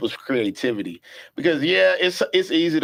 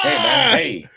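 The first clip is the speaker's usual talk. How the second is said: mock protest; 5 dB louder, slower than usual. Mimicking reaction to folks checking him out.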